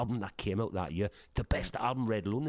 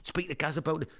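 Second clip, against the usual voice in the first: a hint of the wife, higher; lightly voiced missus pushing him; urging wife; 9 semitones higher than usual. Wife pushing him to call Gaz, lightly voiced higher.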